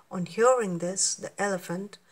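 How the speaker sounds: usual speaking voice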